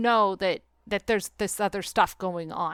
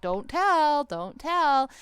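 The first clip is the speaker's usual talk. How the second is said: mock campaign slogan; 6 semitones higher, much slower than usual. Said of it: "the big campaign was like".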